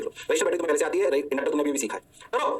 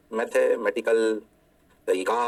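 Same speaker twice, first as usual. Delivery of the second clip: Mathematical तरीका stretched very slow and lower; slow, lowered emphasis on mathematical तरीका; drawn out slowly; 7 semitones lower, much slower than usual. Shown as a demonstration. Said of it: "repeats phrase drawn out".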